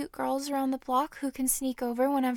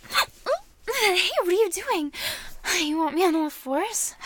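higher, louder, caught off guard; startled protest; 5 semitones higher, 6 dB louder, slower than usual. Acted. Woman questions her partner's sudden move, startled protest. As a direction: protest in surprise, pitched up.

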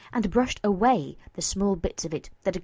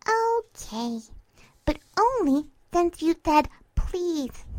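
put-on high, girlish, singsong concession; brightened up; 8 semitones higher than usual. Mare agreeing to get off her friend, suddenly cheery. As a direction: give in with teasing cheer. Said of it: "expression brightened instantly".

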